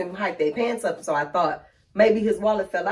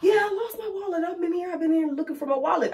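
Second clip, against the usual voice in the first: put-on relieved old man; 10 semitones higher, 3 dB quieter than usual. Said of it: "He's like".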